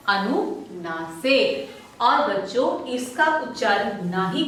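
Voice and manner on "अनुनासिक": drawn out as a model word for the class; slow drawn-out naming. Shown as a demonstration. Teacher to pupils, slowly stretching अनुनासिक. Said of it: "क्या कहते हैं?".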